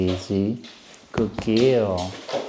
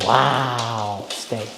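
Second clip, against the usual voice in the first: ordinary delivery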